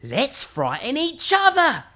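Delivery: little skeleton voice. Acted. Little skeleton suggests they scare each other. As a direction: relish the idea.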